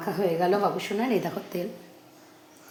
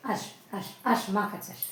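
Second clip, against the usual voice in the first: whispered, much softer coaxing; hushed call to come to mother; 8 dB quieter than usual. Real, not acted. Coaxing baby after bath.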